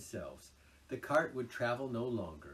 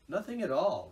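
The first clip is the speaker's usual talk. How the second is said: firm, raised and decisive; 9 semitones higher, 11 dB louder than usual. A narrator's reading. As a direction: settle it firmly. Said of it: "replied the Rat firmly".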